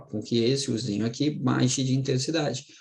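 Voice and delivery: ordinary delivery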